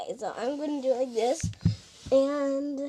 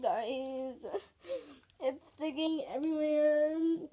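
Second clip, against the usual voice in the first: ordinary talk, nothing marked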